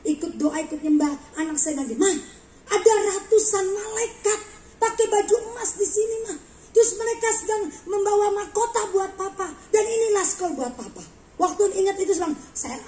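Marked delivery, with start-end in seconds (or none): 2.0-11.2 put-on higher, louder child telling Mama of a wonder; retold excited child. Retold child's excited vision report.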